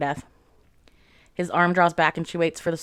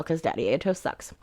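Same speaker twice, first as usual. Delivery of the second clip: usual speaking voice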